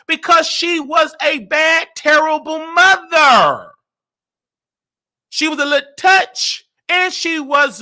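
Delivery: loud, high, emphatic pulpit delivery; preaching. Acted. Explaining his praise like a sermon, preaching. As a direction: preach it at full force.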